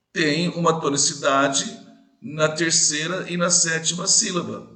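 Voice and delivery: normal talking voice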